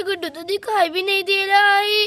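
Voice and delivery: ordinary delivery, nothing marked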